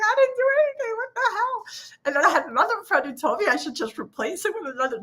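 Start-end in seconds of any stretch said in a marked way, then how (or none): 0.1-1.6 high, indignant mock denial; hammed-up innocent shriek; defensive protest. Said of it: "I'm like".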